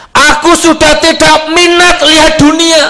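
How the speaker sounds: put-on high, slow boyish voice; boy's voice. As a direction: let the boy's words ring out slowly. Repeats boy's words.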